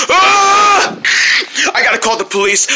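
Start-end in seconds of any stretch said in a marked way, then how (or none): 0.1-1.0 high-pitched scream; frightened scream. Acted. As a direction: cry out while being hunted. Hiding, frightened.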